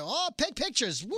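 playfully overexcited quote; mock excited cheer. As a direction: imitate someone thrilled to pose, ending in a woo. Mimicking eager celebrities at photo events.

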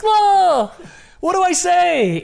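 very high, loud mock squeal; starstruck panic. Fan with the idol on mute, starstruck panic.

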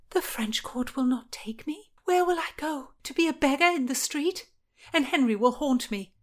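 high, distressed woman's voice; despairing Anne. Acted. Anne has nowhere to flee, despairing.

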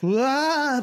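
sudden high yelp of fright; startled yell. Retold or imitated. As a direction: yelp out in panic. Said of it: "I was like".

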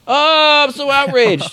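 high, theatrical indignation; mock outrage. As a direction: put on a high-pitched show of being scandalized. Mocking the next outrage.

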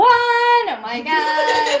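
high, loud, drawn-out swoon; loud lovestruck squeal; gushing. Retold or imitated. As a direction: gush like a smitten teenager, stretched singsong. Gushing over past boyfriend as the one.

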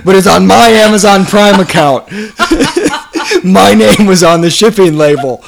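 laughing loudly while arguing; laughing protest. Real, not acted. Laughing protest over book order.

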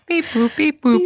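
high beep boop noises, laughing; robot beeps. Pretend. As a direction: chirp like a toy synth. Imitating music machine noises.

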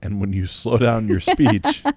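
mock-deliberate slow delivery; slow, emphatic, spaced phrases; playfully slowed down. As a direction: draw it out to tease a fast talker. Playfully models slowed speech back.

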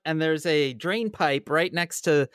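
ordinary talk, nothing marked

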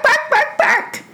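high yaps ending in a laugh; a playful pup barking off; puppy barks. Acted. Dog barks goodbye.

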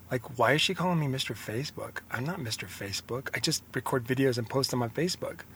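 softly, as if thinking it to himself; the doubting voice in his head, hushed; quiet inner doubt. Retold or imitated. Doubting thoughts creeping into his mind.

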